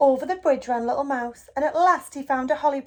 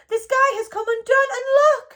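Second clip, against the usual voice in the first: high, louder mock wailing; mild wail; 12 semitones higher, 6 dB louder than usual. A narrator's reading. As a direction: wail it like a frightened child. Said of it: "he wailed".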